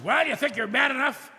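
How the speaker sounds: high, loud jeering dare; taunting sailor. Retold or imitated. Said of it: "He says".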